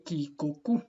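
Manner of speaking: ki, ko, ku each with a hard K sound; ki, ko, ku spelled out slowly; Latin hard K. Shown as a demonstration. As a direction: say "ki, ko, ku" crisply. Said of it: "en latín toda se lee como si fuera K".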